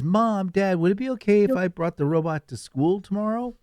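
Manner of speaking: put-on high, sweet child asking; little kid voice. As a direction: ask like a hopeful little kid. Kid asks parents.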